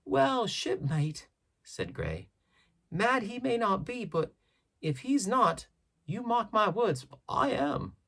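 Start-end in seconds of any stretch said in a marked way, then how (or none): 0.1-1.3 high, slow seaman's voice; old sailor Gray. A narrator's reading. 2.9-8.0 high seaman's voice with a wry warning; a shipmate half-joking that he's the mad one; old sailor Gray. A narrator's reading.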